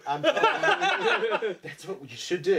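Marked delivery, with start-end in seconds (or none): 0.3-1.6 shrieking laugh; real laugh, much higher. Real, not acted. Laughs at promo idea.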